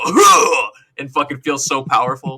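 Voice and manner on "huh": sharp effort grunt on the jump; loud jump grunt. Retold or imitated. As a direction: grunt like a video game hero.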